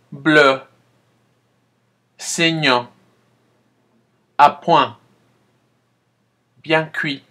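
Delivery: spaced, clear teacher model; model repetition. Shown as a demonstration. Teacher reading vocabulary for students to repeat. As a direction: give each word alone with a pause. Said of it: "Répétez".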